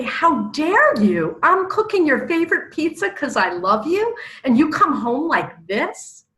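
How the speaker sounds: loud, high-pitched hurt outrage; acted wounded indignation; indignant comeback.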